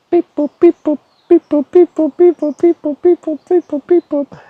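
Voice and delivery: very high robot-like beep boop; high beeping chant. Pretend. Calling out with beeping sounds.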